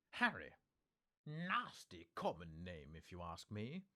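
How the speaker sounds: loud sharp Harry, then slow scornful drawl; disdainful wife. Acted. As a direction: sneer at the name. Wife's disdainful answer.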